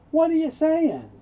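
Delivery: high, loud indignant demand; offended. Acted. Man who doesn't believe what she says, offended. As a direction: demand an explanation as if insulted. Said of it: "he keeps getting offended".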